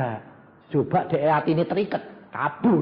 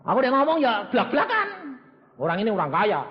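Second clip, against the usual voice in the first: ordinary delivery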